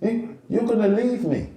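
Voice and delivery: put-on a child who feels abandoned; upset son. Son crying, voiced by father.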